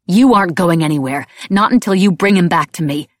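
loud, forceful threat; heated, unyielding order; angry command. A narrator's reading. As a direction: forbid them to leave. Enraged narrator threatens Blaze.

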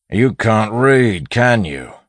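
mock a dim accomplice; scornful bandit. A narrator's reading. Bandit mocks his fellow.

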